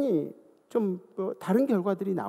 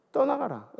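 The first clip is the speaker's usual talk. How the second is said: pitched up, proclaimed command; declaring; 6 semitones higher than usual. Shown as a demonstration. Pastor showing how to proclaim the command. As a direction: declare it with authority. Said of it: "선포하는 거예요".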